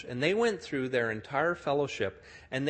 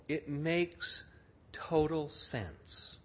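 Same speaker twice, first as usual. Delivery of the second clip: ordinary talk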